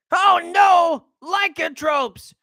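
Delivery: high, loud, hammed-up cry; exaggerated alarm. Spotting lycanthropes, a hammed-up high shout of alarm. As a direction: shout it in mock panic, stretching the last word. Mock alarm at werewolves, redone louder.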